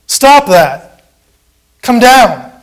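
high, loud jeering cry; taunting crowd yell; mocking shout. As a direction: jeer at him like the mob at the cross. Voicing the mocking world.

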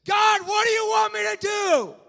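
very high, full-force cry to God; re-enacted all-out plea; desperate shout. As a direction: scream the prayer slowly at heaven. Re-enacting desperate prayer at altar.

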